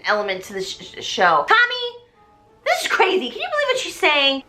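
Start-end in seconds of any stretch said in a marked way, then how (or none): 1.5-4.4 put-on high, indignant mean girl; Chris voice. Chris complains to Tommy, high indignant. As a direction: whine to your boyfriend in disbelief. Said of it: "That's Chris".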